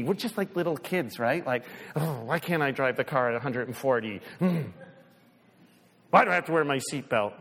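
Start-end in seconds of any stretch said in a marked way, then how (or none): 1.9-7.3 put-on lower, fast teen complaint; whiny kid. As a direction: complain like a spoiled kid about rules. Child complaining voiced.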